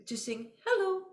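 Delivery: high, sung-up hello after a gasp; mock big inhale. Shown as a demonstration. Teacher mocking a breath too big for hello, high and sung. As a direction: overdo it to show it's silly. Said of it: "You don't need to go".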